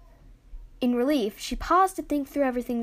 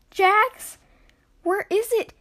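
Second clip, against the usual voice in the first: high, loud, alarmed demand; urgent, raised-pitch alarm; panicked; 10 semitones higher, 7 dB louder, much slower than usual. Acted. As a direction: cry out as panic rises. Panicked over the lost key.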